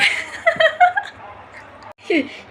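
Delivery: squealing high laughter; very high helpless giggle; high laughing fit. Real, not acted. Mother cracking up.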